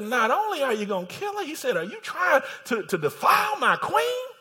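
high, incredulous royal accusation; preacher playing a shocked, angry king; outraged king. Outraged king catches Haman with queen.

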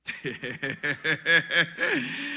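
long, low, quiet chuckle; soft rolling laugh, down low. Real, not acted. Pastor pauses after naming the devil, low chuckle.

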